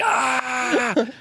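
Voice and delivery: high far-off mock yelp. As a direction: squeal it like a startled man. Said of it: "and I'm just like".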